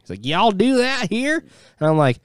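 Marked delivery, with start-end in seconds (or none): 0.2-1.4 slow, high mock Southern drawl imitating a coworker; Southern accent. Retelling a Southern coworker's question, in a mock Southern drawl. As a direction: stretch it out in a hammed-up Southern accent. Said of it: "really Southern".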